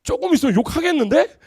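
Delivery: loud imitation of goading friends; acted rowdy friends piling on; teasing attack. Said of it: "막 다 모여갖고 계속 공격을 해".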